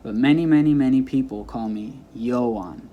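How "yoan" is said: YOAN mispronounced YO-ahn, front stressed; stress on first syllable. Shown as a demonstration. Teacher showing how people misname them, Yoan stressed on YO. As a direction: hit the YO of Yoan. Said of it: "They put the stress on the first".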